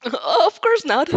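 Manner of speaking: slow, loud, high flustered stammer; high, loud and slow flustered denial. Acted. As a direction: trip over it in a panic. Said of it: "you stammer".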